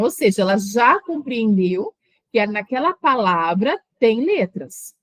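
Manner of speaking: ordinary delivery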